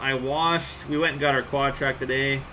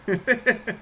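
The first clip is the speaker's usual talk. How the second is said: short laugh; 7 semitones higher than usual. Real, not acted. Laughing at Trevor.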